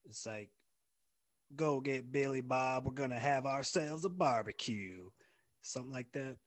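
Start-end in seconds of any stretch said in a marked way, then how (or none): 1.5-5.1 Deep South twang. Shown as a demonstration. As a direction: put on a Deep South accent. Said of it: "we have our Deep South".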